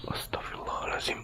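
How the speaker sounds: frightened whisper of a prayer. Real, not acted. Vlogger spooked by a sound outside, whispered prayer. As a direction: breathe the prayer out under your breath. Startled by a noise alone.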